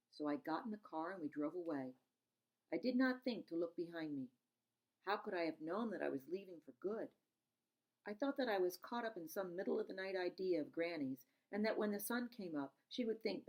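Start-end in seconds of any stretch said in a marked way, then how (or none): none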